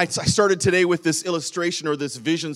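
normal talking voice

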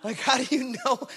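how do you even know that; incredulous retort. Retold or imitated. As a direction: pitch it up high, play the puzzled neighbour. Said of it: "I'm like".

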